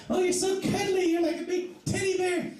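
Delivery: hammed-up swooning lady; mock ladies' voice. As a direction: coo it like a woman gushing over a teddy bear. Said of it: "They'll be like".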